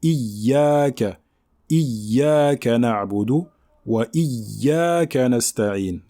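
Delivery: Iyyaka with the Ya held doubled and leaned on, very slow. Shown as a demonstration. Teacher shows students the shadda on Iyyaka, slow and stressed. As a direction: press hard on the doubled Ya in Iyyaka. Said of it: "il faut bien appuyer sur le Ya".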